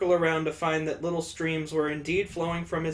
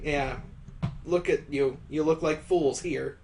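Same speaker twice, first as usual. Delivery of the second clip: ordinary delivery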